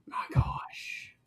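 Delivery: whispered amazement; hushed disbelief. Real, not acted. Co-host hearing the parking story, hushed disbelief. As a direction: react under your breath in a stunned whisper. Stunned listener reacts to near miss.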